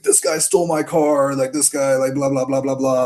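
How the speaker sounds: playing the panicked girlfriend giving a statement; mock freaking out. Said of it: "like, freaking out".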